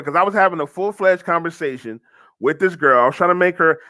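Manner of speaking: ordinary talk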